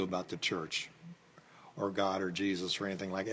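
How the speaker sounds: whisper. Real, not acted. Aside about the kid's faith.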